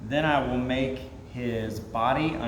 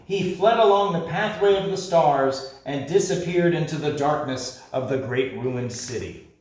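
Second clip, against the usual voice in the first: ordinary delivery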